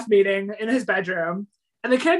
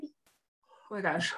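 yawning; 3 semitones lower, 12 dB quieter, much slower than usual. Real, not acted.

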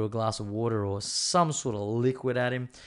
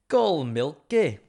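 slow, higher, louder mock Scottish accent; 6 semitones higher, 7 dB louder, much slower than usual. Shown as a demonstration. Host demonstrating how they ask Siri to call a friend, mock Scottish accent. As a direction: put on a drawn-out Scottish accent. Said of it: "like, a Scottish accent".

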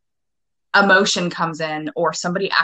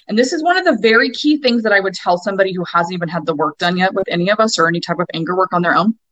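ordinary talk

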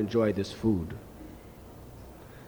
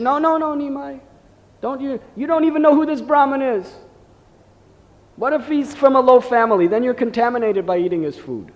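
much higher, louder mock scolding; teasing ladies; 9 semitones higher, 5 dB louder than usual. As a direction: tease the child like fussing aunties. Neighbour ladies tease Nimai.

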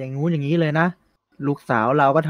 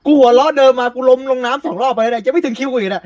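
higher, louder excited complaint; excited outburst; 7 semitones higher, 5 dB louder than usual. Real, not acted. Player complains about waiting for his cue, excited and loud. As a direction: blurt it out, worked up.

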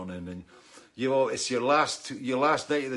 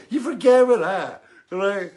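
loud mock quote; 9 semitones higher, 12 dB louder than usual. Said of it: "He said".

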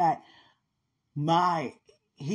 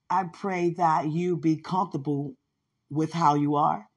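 ordinary talk, nothing marked

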